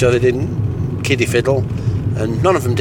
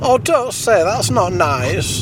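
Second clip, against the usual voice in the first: higher prissy puppet voice; higher puppet voice, first line slowed; prim puppet objecting; 8 semitones higher, slower than usual. Acted. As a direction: tut at him like a prim puppet.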